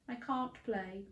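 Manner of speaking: soft, down sad little voice; dismal. Acted. Dan tells the coach he can't play, dismally. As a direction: sound defeated. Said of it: "sounding rather dismal".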